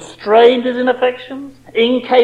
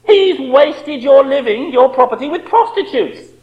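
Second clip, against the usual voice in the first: resentful quote; 6 semitones higher, 4 dB louder than usual. Retold or imitated. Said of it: "See what he says?".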